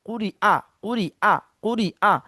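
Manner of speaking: ordinary talk, nothing marked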